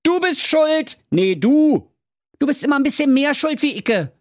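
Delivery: high, squabbling shouts in Berlin dialect; quarrelling villagers. Acted. Villagers throw blame at each other, high squabbling shouts. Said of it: "Sie riefen".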